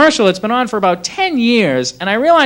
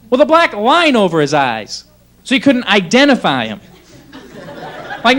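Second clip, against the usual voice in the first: ordinary delivery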